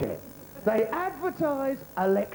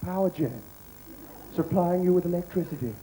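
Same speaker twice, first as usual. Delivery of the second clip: mock ad voiceover; 10 semitones lower than usual. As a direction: intone it like a TV sponsor tag. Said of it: "every night it says".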